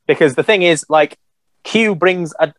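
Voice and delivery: usual speaking voice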